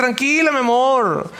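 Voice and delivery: slow, drawn-out, high soothing; mock reassurance. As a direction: soothe your spouse as if it were nothing. Voices spouse shrugging off lost child. (tranquilizando en broma, agudo y alargado)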